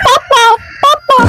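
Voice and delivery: put-on extremely high-pitched squeaky baby. Baby asked to name its father, squeaky baby voice. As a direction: chirp it like a cartoon toddler.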